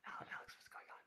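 hushed, secretive whisper; barely voiced whisper. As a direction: whisper it to a friend. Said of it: "Why are we whispering?".

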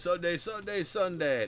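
rising announcer chant, lightly done; mock hype ad shout; monster truck ad. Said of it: "advertise it as".

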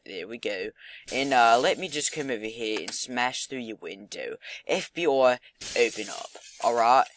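amateur, hammed-up Aussie accent; Australian accent. Gamer smashing through windows, in a shaky attempt at an Australian accent. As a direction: have a rough go at sounding Australian. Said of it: "I'm not the best at Australian accents".

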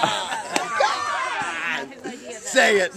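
real shout of impatience; exasperated shout. Real, not acted. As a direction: yell it out, pushing for the answer. Exasperated demand in heated debate.

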